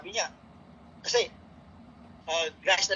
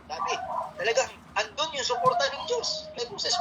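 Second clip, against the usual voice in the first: ordinary delivery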